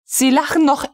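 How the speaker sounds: barely contain the fury; pale with rage. A narrator's reading. Frau von Melin, pale with rage, at the still-laughing officer. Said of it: "fragte Frau von Melin bleich vor Zorn".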